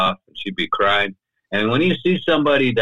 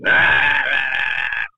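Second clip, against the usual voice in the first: imitated drunken snarling noise; snarling rah; 5 semitones higher, slower than usual. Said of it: "like, snarling".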